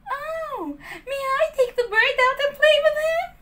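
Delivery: high, fast, eager plea; excitedly. Acted. Pinocchio begging to play with the bird, excited. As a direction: burst out with a child's excitement. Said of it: "Pinocchio asked excitedly".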